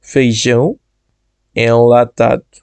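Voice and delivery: normal talking voice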